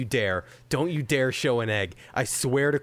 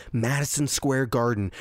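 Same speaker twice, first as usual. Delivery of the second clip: ordinary delivery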